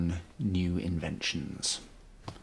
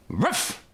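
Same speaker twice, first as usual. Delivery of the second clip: dog bark; 10 semitones higher than usual. Acted. Robot dog Alpha barks.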